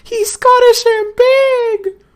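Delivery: very high, loud, slow squeal; laughing squeal. Real, not acted. Player delighted by big Scottish governor, laughing squeal.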